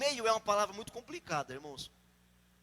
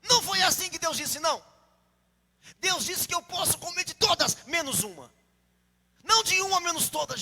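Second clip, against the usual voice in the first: raised, high-pitched firm rebuttal; Eve protesting; 7 semitones higher, 5 dB louder than usual. Acted. Eve correcting the serpent on God's words, high insistent protest. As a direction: argue back hard, pitch up, dropping on the tail. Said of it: "Ela devia falar assim".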